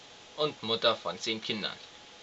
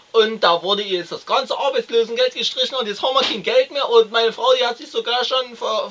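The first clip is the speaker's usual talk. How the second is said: loud, higher, rambling lout; blustering boor ranting about his wife; crude husband; 10 semitones higher, 8 dB louder than usual. Acted. Plays the crude husband Herr M.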